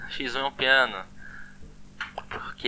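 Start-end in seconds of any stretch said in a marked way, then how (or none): 2.3-2.7 whisper. Real, not acted. Aside about playing piano.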